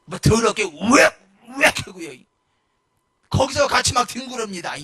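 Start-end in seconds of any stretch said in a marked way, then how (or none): 0.1-2.1 mock retching. Mimics a drunk vomiting.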